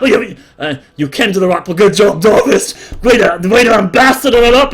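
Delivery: high, loud proud dad; proud dwarf father. Acted. Father praising son for bringing buyers, loud. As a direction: beam at your son.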